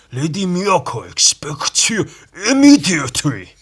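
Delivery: hammed-up Japanese accent shouted. Shown as a demonstration. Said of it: "stereotypical Japanese accent".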